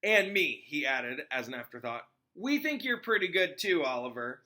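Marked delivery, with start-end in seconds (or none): 0.0-0.6 character voice. Acted. Afterthought quote, character voice. 2.3-4.4 character voice. Acted. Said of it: "said George".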